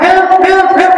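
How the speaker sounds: put-on high, loud cry for help from a child. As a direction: call out like a frightened kid. Child shouting that there's fire.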